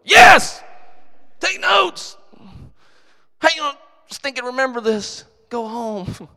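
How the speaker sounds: explosive pulpit yell; preaching shout. Real, not acted. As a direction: yell it at the congregation. Preacher answering imagined question.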